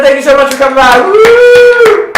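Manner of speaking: loud, high show-host sign-off; announcer send-off. Pretend. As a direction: whoop the guest offstage like a stage announcer. Host signs off the character guest.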